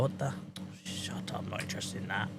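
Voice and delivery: very quiet, breathy grumble; muttered aside. Real, not acted. Streamer dismissing a menu option, quiet mutter. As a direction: mutter it under your breath.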